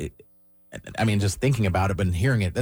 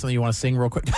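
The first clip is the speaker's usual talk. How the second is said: ordinary talk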